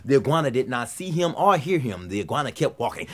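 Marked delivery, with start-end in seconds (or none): none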